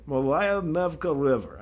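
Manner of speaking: Malaya Nevka River in a Russian accent. Shown as a demonstration. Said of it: "I have to say it with my Russian ac-, accent".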